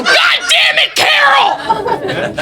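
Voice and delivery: high-pitched furious yell; wrath impression. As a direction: explode at the ex-wife in hammed-up rage. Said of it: "Here's my impression of wrath".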